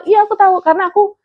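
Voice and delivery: high and quick, proud to know; eager reply. Retold or imitated. Daycare man explaining he took the training, high and fast. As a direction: rattle it off eagerly. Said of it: "katanya gitu kan".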